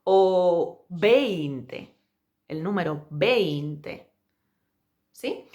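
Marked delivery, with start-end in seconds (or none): 0.0-4.1 el número veinte very slowly. Shown as a demonstration. Teacher modelling veinte slowly for learners. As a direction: draw out el número veinte syllable by syllable. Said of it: "pronunciar despacio".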